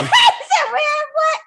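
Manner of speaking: high, loud laughing mimicry; mimicked reaction. Player mimics a friend's shocked reply, high and loud through laughter. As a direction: squeal it back, mocking his reaction. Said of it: "He said".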